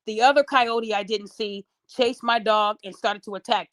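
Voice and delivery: ordinary talk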